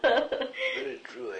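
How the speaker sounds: laughing through the words. Real, not acted. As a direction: chuckle it out, amused. Laughs at the lordship remark.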